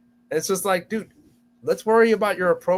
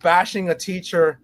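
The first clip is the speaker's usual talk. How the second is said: normal talking voice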